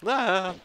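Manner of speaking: short, high, loud startled yelp; startled scream. Real, not acted. Gamer startled by a rat out of nowhere, startled scream. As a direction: cry out in sudden fright. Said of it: "appeared out of nowhere and scared me".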